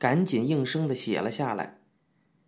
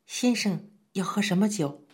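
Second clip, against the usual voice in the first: higher, timid waitress voice; timid question; 6 semitones higher than usual. A narrator's reading. Waitress timidly asks the guests, a lightly higher voice. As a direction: ask shyly, afraid to intrude. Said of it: "怯生生地问道".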